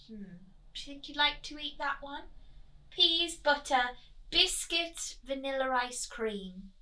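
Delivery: plain reading voice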